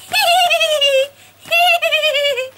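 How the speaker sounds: very high, loud squeaky hee hee; high squeaky duckling tittering; ducklings' giggle. Retold or imitated. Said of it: "baby ducks are running behind going".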